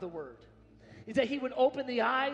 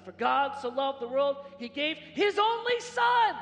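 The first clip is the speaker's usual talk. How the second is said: "only Son" shouted much higher and louder, slow; shouted stress on Son; 5 semitones higher, 10 dB louder, slower than usual. Shown as a demonstration. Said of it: "emphasizing something differently".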